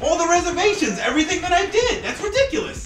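high, disbelieving outrage with short laughs; incredulous. Acted. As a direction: raise it up in angry disbelief. Arguing about cancelled trip plans.

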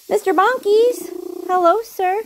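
high, loud playful greeting to the cat; mock-formal greeting. Real, not acted.

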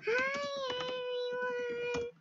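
high drawn-out greeting; singsong hi. Real, not acted. Kid singing a hello to everyone in the game. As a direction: sing it out slowly.

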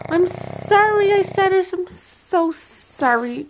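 very high, drawn-out pet-talk voice; cooing apology. Real, not acted. Owner says sorry to dog for saying walk, cooing.

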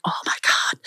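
a whispered oh my God; awed whisper. Retold or imitated. Awed student whispering about the philosophers. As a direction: breathe it out in awe.

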